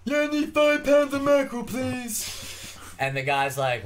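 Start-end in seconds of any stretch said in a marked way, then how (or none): 0.0-2.8 put-on high strained voice of a deaf girl, much higher. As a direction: squeeze it up high and mushy like the deaf girl in the joke. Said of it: "And she's like".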